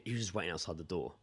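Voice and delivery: usual speaking voice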